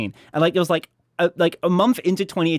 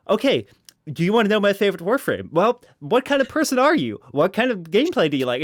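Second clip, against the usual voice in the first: bright, higher chirpy host pitch; hyped youtuber voice; 6 semitones higher, 3 dB louder than usual. Pretend. Youtuber greeting a viewer, eager and higher. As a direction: sell it like an eager video host. Imagined youtuber video intro.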